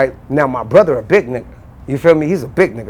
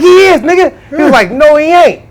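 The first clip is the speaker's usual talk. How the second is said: high, louder yelling back and forth; heated argument; 12 semitones higher, 7 dB louder than usual. Retold or imitated. Brothers arguing whether Dash is skinny, high and loud. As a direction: bicker hard.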